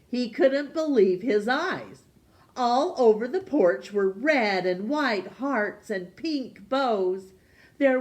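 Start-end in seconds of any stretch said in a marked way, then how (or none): none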